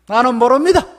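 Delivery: 모릅니다 pushed loud, rising in protest. Acted. Peter denying Jesus, a loud insistent 모릅니다.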